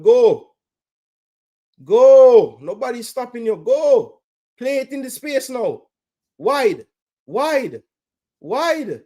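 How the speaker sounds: high, loud yells to go; real fan yelling at the screen; urging shouts. Real, not acted. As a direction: scream at the winger to run. Screaming attacker forward.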